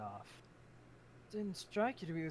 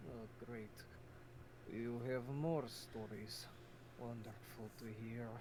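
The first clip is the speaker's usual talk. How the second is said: sarcastic; 3 semitones lower, 3 dB quieter, slower than usual. Acted. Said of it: "speaking sarcastically".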